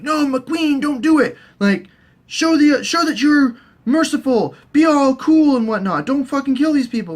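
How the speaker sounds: high, pleading mock voice, slowed at the start; mock Tyrion pleading. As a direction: beg the queen in a squeaky put-on voice. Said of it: "Tyrion's all like".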